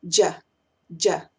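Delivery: "Ya" said as "jah", low; D Y plus A. Shown as a demonstration. Teacher dropping the first D, just "jah". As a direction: start "ya" with a j sound: "jah". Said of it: "solamente digo la D Y más A".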